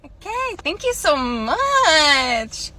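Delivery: slow, sugary thanks; customer service voice. Acted. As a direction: stretch it out sweetly. Fake cheerful cashier.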